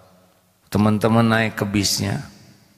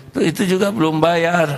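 teacher voice; 8 semitones higher than usual. Retold or imitated. Teacher points to another pupil.

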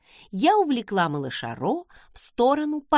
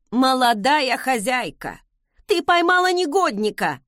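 loud, high cry of relief; raised, animated call to the young mistress; nanny's exclamation; 4 semitones higher, 4 dB louder than usual. A narrator's reading. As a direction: exclaim as a fussing old nanny. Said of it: "воскликнула нянюшка".

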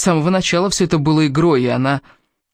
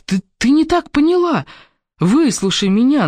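high, urgent, pleading insistence; desperate protest, pitched well up; insistent; 10 semitones higher than usual. A narrator's reading. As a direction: stammer in, then plead to be heard. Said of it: "настаивал Кевин".

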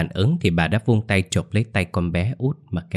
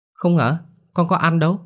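put-on much higher child voice; little girl voice; 10 semitones higher than usual. A narrator's reading. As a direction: protest like a small girl. Child denying to mother.

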